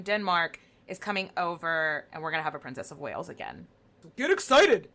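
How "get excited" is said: high, loud hype-man shout; energy drink ad. Acted. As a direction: pump up the crowd like a hype ad. Hyping up co-host like an ad.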